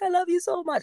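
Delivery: high-pitched imitation of a tearful friend; tearful gushing. Crying friend telling her she loves her, mock tearful. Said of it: "now she's crying because she's like".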